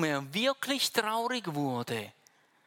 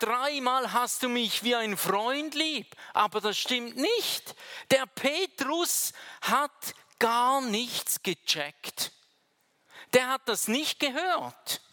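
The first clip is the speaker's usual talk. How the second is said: preaching at full force; 6 semitones higher, 4 dB louder than usual. Acted. Preaching climax.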